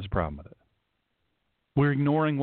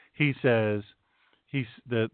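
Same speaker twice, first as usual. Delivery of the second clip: ordinary talk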